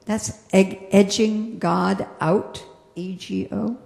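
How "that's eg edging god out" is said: ordinary talk, nothing marked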